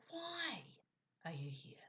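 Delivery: "Why" pitched very high, then a pause; stress on "Why". Shown as a demonstration. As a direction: punch "Why" high, drop the rest. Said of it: "When you change your tone".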